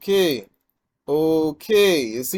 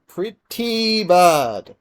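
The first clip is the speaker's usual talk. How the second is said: very slow, loud, higher "Pretty bad"; drawn-out dismay; 4 semitones higher, 7 dB louder, much slower than usual. Real, not acted. Gamer watching the match go wrong, drawn-out dismay.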